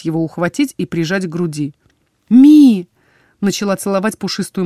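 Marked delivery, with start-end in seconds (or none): 2.3-2.9 loud cry of the name; loud call. A narrator's reading. The heroine catches her familiar, loud cry of his name. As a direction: cry out as you catch him.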